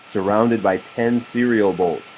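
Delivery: ordinary delivery, nothing marked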